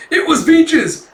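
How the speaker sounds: very high, loud exclamation; acted breathless-sounding excitement; excited announcement. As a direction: burst in with the news, high and loud. Apprentice running back with news.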